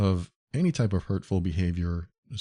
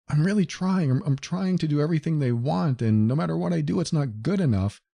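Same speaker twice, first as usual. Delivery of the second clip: higher, strained voice of someone trying too hard; pleading victim; 7 semitones higher than usual. Retold or imitated. Victim telling the counselor nothing works, higher and pleading. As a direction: plead like a worn-down victim, pitched up. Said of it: "when I hear from people that tell me".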